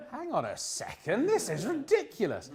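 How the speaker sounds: laughing, then high mock disbelief; incredulous outburst. Mock outrage at cookie law.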